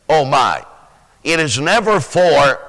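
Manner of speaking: normal talking voice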